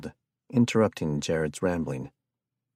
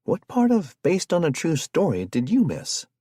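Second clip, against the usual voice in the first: put-on lightly raised conspiracy-minded young man; excitable Jared; 11 semitones higher, 4 dB louder than usual. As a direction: sell the curse theory with eager alarm. Jared's voice again.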